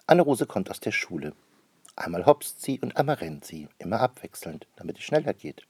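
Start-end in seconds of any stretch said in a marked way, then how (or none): none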